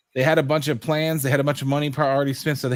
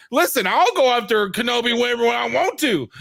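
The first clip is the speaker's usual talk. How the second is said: cocky Vader; 8 semitones higher, 3 dB louder than usual. Retold or imitated. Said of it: "on his damn throne going".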